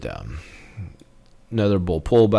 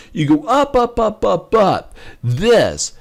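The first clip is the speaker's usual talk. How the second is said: excited; 7 semitones higher, 5 dB louder, slower than usual. Real, not acted. Excited chart commentary.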